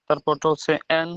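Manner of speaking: normal talking voice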